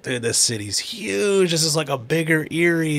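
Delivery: retold younger self. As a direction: recall his wide-eyed first visit. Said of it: "I was like".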